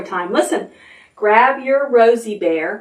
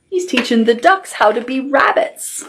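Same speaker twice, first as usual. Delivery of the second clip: ordinary delivery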